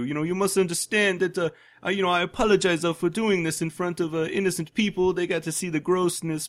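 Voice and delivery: high, loud, hammed-up British accent; mock British accent. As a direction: imitate the killer in an overdone British accent. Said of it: "He's talking with his British accent".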